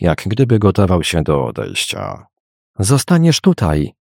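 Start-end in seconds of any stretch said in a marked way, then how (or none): 2.8-3.9 higher-pitched, insistent call. A narrator's reading. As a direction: call out to stop someone leaving. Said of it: "zawołała Dolly". (zawołanie podwyższonym głosem)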